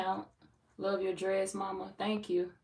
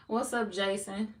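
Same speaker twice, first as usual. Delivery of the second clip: ordinary talk, nothing marked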